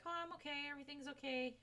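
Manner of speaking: high, sing-song mock reassurance; fake okay. Mocking someone's fake cheer while hiding their dissatisfaction. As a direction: brush it off with a forced bright okay. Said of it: "this energy of like".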